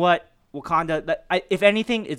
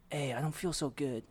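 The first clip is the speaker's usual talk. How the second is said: softer, faded quote of the dying hero; soft fading plea; 12 dB quieter than usual. Retold or imitated. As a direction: say it weak and frightened. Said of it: "He was like".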